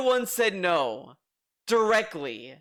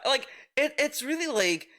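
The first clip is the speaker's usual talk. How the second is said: ordinary delivery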